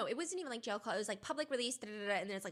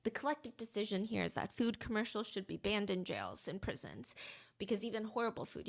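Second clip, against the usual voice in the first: ordinary talk, nothing marked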